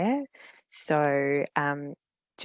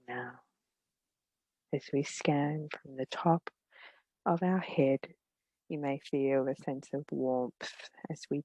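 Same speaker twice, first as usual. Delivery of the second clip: gentle meditation guide, long pauses between phrases; unhurried, soothing guidance, letting each phrase settle; guided meditation. Real, not acted.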